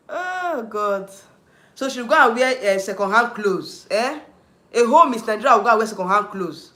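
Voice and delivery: loud, heated outburst; indignant loud complaint; angry rant. Real, not acted. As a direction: rant at the idea, raising the voice. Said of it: "I'm angry".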